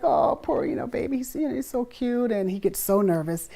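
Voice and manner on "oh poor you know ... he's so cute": put-on high cooing over a baby; cooing audience. Audience cooing at nervous little boy, high coo. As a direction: coo like an adoring crowd. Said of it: "they're just like".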